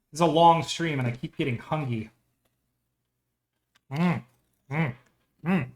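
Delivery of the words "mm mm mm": chewing chips while talking; mouth full. Real, not acted. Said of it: "Sorry if you guys can hear me chew".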